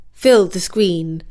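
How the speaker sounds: usual speaking voice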